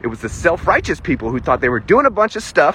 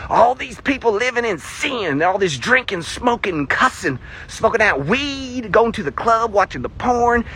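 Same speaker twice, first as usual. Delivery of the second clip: higher-pitched mock Southern preacher rant; Southern accent preacher; 4 semitones higher than usual. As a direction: drag out the sins in a hammed-up Southern accent. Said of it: "poor Southern accent".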